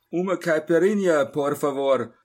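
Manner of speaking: loud Portuguese call across the room; calling to the bar. Acted. Narrator calls his drink order to the barman, loud. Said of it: "rief ich hinüber zur Schank".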